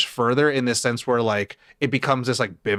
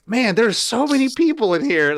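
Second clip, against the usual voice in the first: amazed; 9 semitones higher than usual. Retold or imitated. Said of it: "And I'm like".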